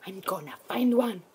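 unvoiced promise to herself; determined whisper; whispered vow. Real, not acted. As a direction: whisper it with resolve. Determined repeat.

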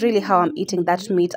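ordinary delivery

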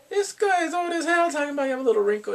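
high, loud indignant rant; mock outrage. Fan objecting to the old man's wrinkle comment, loud mock outrage. Outraged at blind date's remark.

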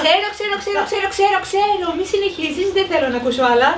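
high, insistent repeated I know; cutting him off. Acted. Salad lady interrupts the boy's junk food list, high and insistent. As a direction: stop him mid-list.

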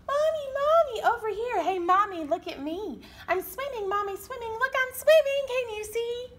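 high, loud gleeful calling; excited little chick. Acted. Little penguin calling to mommy, high and thrilled. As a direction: show off to mommy with joy.

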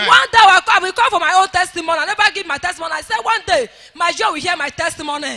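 loud, forceful church prayer; fervent prayer. Real, not acted. Testifier blessing the daddy G O before church, fervently. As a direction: pray it out with full force. Prays aloud for the G O.

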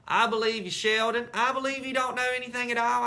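high, loud teasing shout through cupped hands. Acted. Junie B shouting to Sheldon through cupped mitts, loud tease. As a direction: shout it so the whole yard hears. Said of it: "I shouted".

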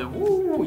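high swooping ooh of wonder. Acted. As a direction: gasp out a high ooh at magic. Mock wonder at magic light.

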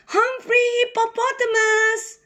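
put-on high child calling a name. A narrator's reading. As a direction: call out high across the hill. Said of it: "called a little girl hippo".

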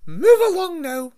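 very high, loud, sharp command; a strict teacher cutting in; sharp stern voice. Acted. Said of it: "said a sharp voice".

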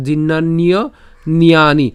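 জীর্ণান্যনিয়ানি slowly picked apart; laboured pronunciation. Shown as a demonstration. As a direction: stretch জীর্ণান্যনিয়ানি out, pausing before "niyaani". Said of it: "দরকার নেই এত কষ্ট". (জীর্ণান্যনিয়ানি কষ্ট করে ভেঙে ধীরে)

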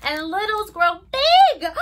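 dramatic storybook swell; big exclaim. Pretend. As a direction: make it big for the kids. Story climax, big gesture.

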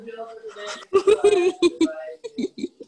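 bursting into a high giggle. Real, not acted. Student laughing at her own whisper in class. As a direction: break into laughter after holding it in.

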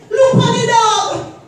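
high, loud, drawn-out exclamation; mock amazed outburst. Boy seeing a lady's dressed-up dog, loud mock amazement. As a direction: exclaim slowly at the sight.

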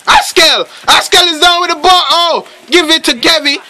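energetic live commentary; excited play-by-play. Real, not acted. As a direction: call each player with urgency. Calling live play with urgency.

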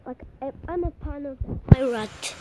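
ordinary delivery

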